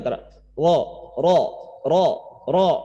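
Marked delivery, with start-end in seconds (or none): 1.9-2.7 "ro, ro" with a light hidden trill; Arabic ra. Shown as a demonstration. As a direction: tap "ro" once, barely vibrating. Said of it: "getarannya rada tersembunyi".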